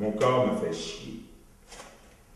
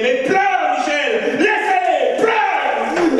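much higher, loud ordering; commanding shout; 15 dB louder, much slower than usual. Retold or imitated. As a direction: yell at him to cry. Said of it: "Françoise m'ordonne de pleurer".

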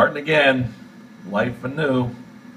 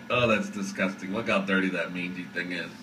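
muttered disgust; 10 semitones lower, 11 dB quieter than usual. Real, not acted. Disgusted by the spider.

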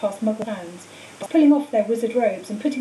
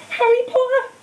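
put-on much higher, excited little-girl squeal; 14 semitones higher, 5 dB louder than usual. A narrator's reading. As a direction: squeal his name like a starstruck child. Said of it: "she squealed".

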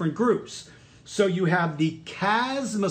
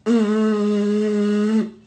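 higher, loud tube buzz; smaller-crest tone; 4 semitones higher, 7 dB louder than usual. Shown as a demonstration. Teacher showing a smaller crest changes tone, higher buzz. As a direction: hold a steady higher honk. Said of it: "changes the tone".

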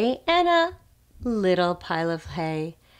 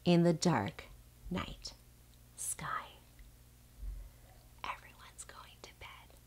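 from soft voice fading to a hushing whisper; 13 dB quieter than usual. Acted. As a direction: fade out to a bedtime shh. Fading bedtime ending.

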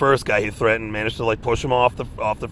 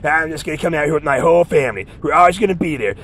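menacing monster voice; 4 semitones higher than usual. Retold or imitated. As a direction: ham up the stranger's murder threats. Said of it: "it's just like".

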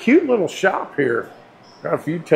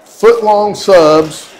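foot long subs drawn out slowly, each word weighted; boastful drawn-out foot long subs; slow emphatic; 4 semitones higher, 9 dB louder, much slower than usual. Real, not acted. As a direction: savor the phrase foot long subs, stretching it out. Showing off the sub's length.

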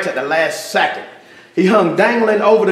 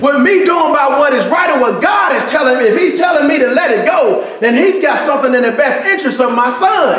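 rising shout from the pulpit; preaching at full force; 4 semitones higher than usual. Acted. Pastor preaching to the congregation, shouting.

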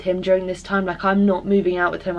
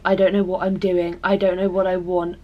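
normal talking voice

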